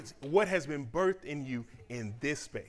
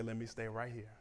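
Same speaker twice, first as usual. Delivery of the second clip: calm acceptance; 5 semitones lower, 9 dB quieter than usual. Retold or imitated. Accepting the process.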